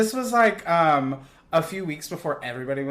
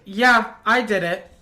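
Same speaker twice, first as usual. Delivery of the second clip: louder, higher, unrepentant; defiant confession; 7 semitones higher, 6 dB louder than usual. Retold or imitated. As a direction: own it proudly. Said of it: "She's like".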